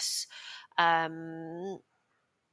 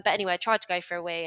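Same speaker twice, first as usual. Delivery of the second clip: normal talking voice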